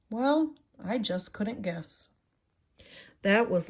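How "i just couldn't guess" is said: ordinary delivery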